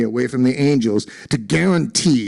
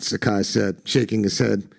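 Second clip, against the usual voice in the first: ordinary delivery, nothing marked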